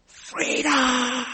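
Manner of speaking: loud high battle cry of freedom; shouted cry. Retold or imitated. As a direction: yell it at the top of your lungs. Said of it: "cries out at the top of his voice".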